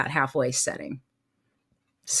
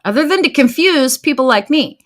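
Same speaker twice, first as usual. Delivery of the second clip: higher and louder in mock exasperation; exasperated; 8 semitones higher, 6 dB louder than usual. To her viewers about the confusing button, mock exasperation. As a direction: throw up your hands at a silly feature, pitched up.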